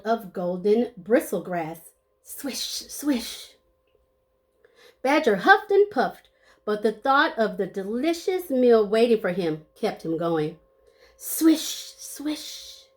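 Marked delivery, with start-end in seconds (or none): 2.2-3.6 breathy whispered sound effect; whispered swish. Acted. 11.2-12.8 breathy whispered sound effect; whispered swish. Acted.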